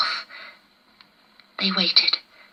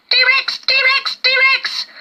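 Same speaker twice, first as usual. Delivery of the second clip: very high, loud repeated warning; alarm call; 3 dB louder, much slower than usual. A narrator's reading. Lizard warning everyone the tyrant is coming, shrieking. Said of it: "calling his warning".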